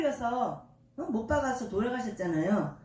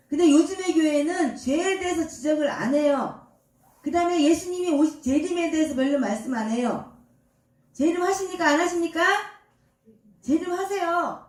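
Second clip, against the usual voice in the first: loud, raised-pitch pulpit challenge; forceful preaching; 4 semitones higher, 7 dB louder than usual. Real, not acted. Preacher challenging churches silent on sin, forceful. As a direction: press the congregation hard at full voice.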